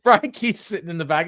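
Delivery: normal talking voice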